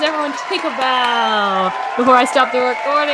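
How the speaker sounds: loud, excited cheer. Real, not acted. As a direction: whip up applause. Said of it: "Yay, everyone!".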